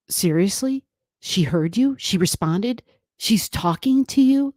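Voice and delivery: imitating mom's voice climbing with each question; retold emotional, incredulous questions; high-pitched disbelief. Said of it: "it got really high pitched".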